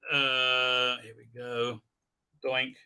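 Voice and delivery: normal talking voice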